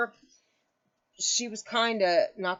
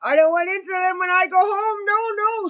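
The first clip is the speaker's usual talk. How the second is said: much higher, louder pleading wail; crying mother; 13 semitones higher, 8 dB louder than usual. Retold or imitated. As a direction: plead through tears like the scared mother. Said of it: "and she kept crying".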